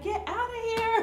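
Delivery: high, laughing mock dismissal; laughing disbelief. Mother reacting to the stranger, laughing high. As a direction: laugh it off in delight. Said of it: "I'm like".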